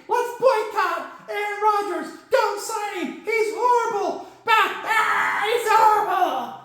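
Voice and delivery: high, goofy sidekick puppet voice telling a joke; Corky's puppet voice. Acted. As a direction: keep Corky high and silly throughout. Corky tells the genie joke.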